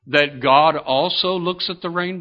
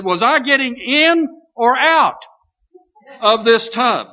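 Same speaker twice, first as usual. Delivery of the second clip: higher, lightly hammed-up yell of a confused old man; mock old man yell; 7 semitones higher, 3 dB louder than usual. Old brother in the bathtub calling downstairs, a higher puzzled mock yell. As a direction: call down the stairs, puzzled and forgetful. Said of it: "he yelled downstairs".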